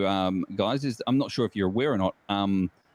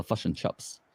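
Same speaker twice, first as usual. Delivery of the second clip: clipped Kiwi vowels on "fush and chops"; joking New Zealand impression; mock New Zealand accent; 4 semitones lower, 6 dB quieter, slower than usual. Shown as a demonstration. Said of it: "I can actually speak New Zealand".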